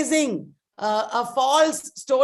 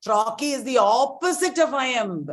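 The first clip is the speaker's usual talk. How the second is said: normal talking voice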